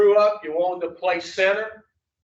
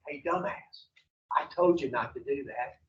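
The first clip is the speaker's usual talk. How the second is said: low, soft inner-voice scolding; a quiet told-you-so from inside the head; subdued, voiced reproach, still kept small; little voice, slightly louder; 6 semitones lower, 9 dB quieter than usual. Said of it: "come back a little louder a second time".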